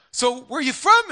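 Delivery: loud, high, eager question; high-pitched, loud mock eagerness; eager questioner. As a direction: imitate someone keen to engage you. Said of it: "trying to engage on this".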